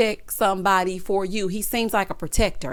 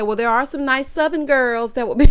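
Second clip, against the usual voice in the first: louder, higher mock snap dissolving into loud laughter; 5 semitones higher, 5 dB louder than usual. Host imagining snapping back at the mom, then laughing. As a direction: fake a sassy comeback, then crack up. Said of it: "I started to snap and say".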